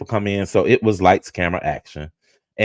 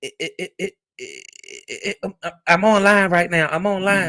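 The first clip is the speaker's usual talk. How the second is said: flustered stammer; 8 semitones higher, 4 dB quieter than usual. Retold or imitated. Skillet stammering, caught out.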